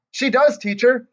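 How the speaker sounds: put-on little girl shouting an answer; shouting back. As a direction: shout back across the room. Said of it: "That Grace shouted back".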